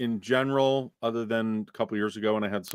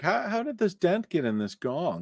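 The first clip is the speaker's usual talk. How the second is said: puzzled onlooker voice; 9 semitones higher, 4 dB quieter, slower than usual. Retold or imitated. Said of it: "People are like".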